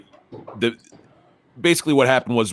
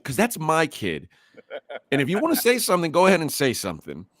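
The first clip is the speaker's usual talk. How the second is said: higher-pitched indignant dare; retold confrontation, voice raised in pitch; protective challenge; 6 semitones higher than usual. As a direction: stand up for your kid, daring a reply. Retold confrontation defending his son.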